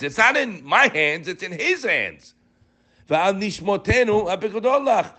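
high-pitched, insistent sermon delivery; fervent preaching. Real, not acted. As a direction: preach it high, driving each phrase home. Preaches on the prayer.

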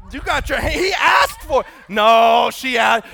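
high, very loud shout across the room; playful hollering. Real, not acted. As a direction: holler over an excited crowd. Hollering at crowd during egg giveaway.

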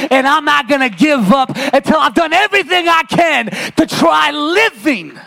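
climbing preacher's call to the congregation; rising preaching. Acted.

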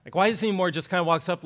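ordinary talk, nothing marked

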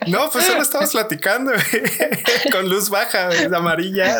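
high, breaking into laughter through the quote; amused high mock complaint; laughing. Real, not acted. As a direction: laugh your way through retelling it. Retelling party, laughing hard.

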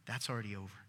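soft, low, consoling; quiet tender reassurance; gentle comfort. Acted. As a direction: hand on his shoulder, easing his grief. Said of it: "put his hand on him and said".

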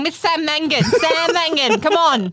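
high, loud call for Sam Mangan, Sam Mangan; calling out loudly. Pretend. Trapped in a lift, calling for the host to come, loud and high. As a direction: yell for Sam Mangan to come and rescue you. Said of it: "So you want me in an elevator going".

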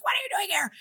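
high surprised whisper; startled whispered question. Retold or imitated. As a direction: hiss it so no one hears. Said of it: "She's like".

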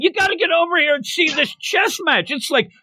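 high excited mock yell. Crowd calling everyone to watch the chess, high mock yell. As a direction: call everyone over, pitched high. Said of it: "Everybody's yelling".

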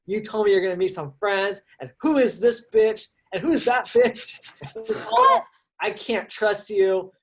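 acted outrage at a lying boyfriend; pissed-off girlfriend. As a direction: pitch climbing into an indignant rant. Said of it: "She's pissed".

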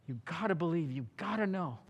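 ordinary talk

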